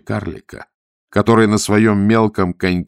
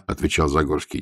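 ordinary delivery, nothing marked

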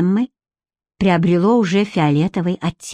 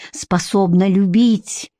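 usual speaking voice

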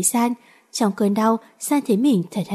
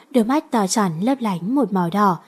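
ordinary delivery

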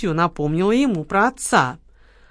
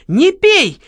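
alarmed shout; 10 semitones higher, 8 dB louder than usual. A narrator's reading. Lola stops Kamila drinking.

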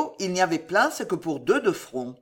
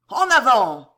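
loud, higher-pitched charge order; yelled command; 4 semitones higher, 8 dB louder than usual. A narrator's reading. Officer to his men after the volley, yelling. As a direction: shout the troops forward. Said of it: "il hurla".

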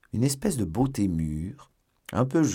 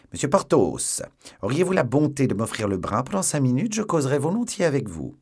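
higher, louder, tightly controlled anger; contained fury; 6 semitones higher, 5 dB louder than usual. Acted. La procureuse parle à Porthos avec une fureur contenue. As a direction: hold the rage in behind politeness. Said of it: "avec une fureur concentrée".

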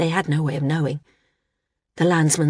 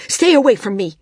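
higher, louder warning; 5 semitones higher, 7 dB louder than usual. A narrator's reading. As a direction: shout them off. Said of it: "San said loudly".

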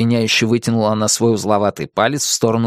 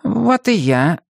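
slow, higher-pitched mild sigh; sighing cat; 7 semitones higher, much slower than usual. A narrator's reading. Cat admits he understands nothing, sighing. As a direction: draw it out with a touch of a sigh. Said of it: "вздохнул кот".

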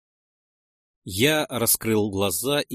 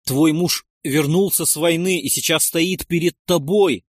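raised, high impassioned plea; loud, urgent declaration; desperate insistence; 5 semitones higher, 6 dB louder than usual. A narrator's reading. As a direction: make her believe it. Desperately insists to his wife.

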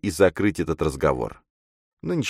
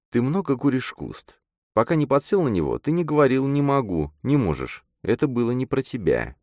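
put-on reproachful girl character; 8 semitones higher than usual. A narrator's reading. Girl tells her friend he has changed, reproachful. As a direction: chide him in a higher feminine voice.

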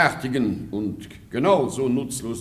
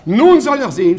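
loud challenge; 9 semitones higher, 10 dB louder than usual. Acted. Baron about to confront courtier.

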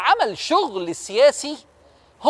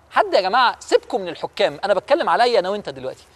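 usual speaking voice

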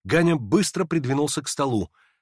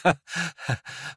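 laugh; 5 semitones higher than usual. A narrator's reading. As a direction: let out a nervous little laugh. Hippolyte laughs in his agitation.

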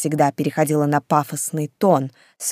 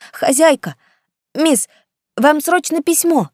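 put-on eager boy servant; 11 semitones higher, 4 dB louder than usual.

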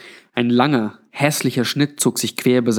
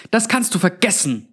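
mild angry yell; 8 semitones higher than usual. A narrator's reading. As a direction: refuse flatly in a much higher voice. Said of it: "schrie Melinda". (trotziger, hoher, gedämpfter Aufschrei)

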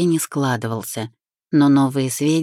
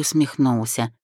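ordinary delivery, nothing marked

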